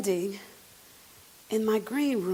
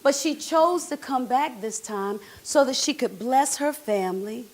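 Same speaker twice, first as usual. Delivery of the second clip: ordinary delivery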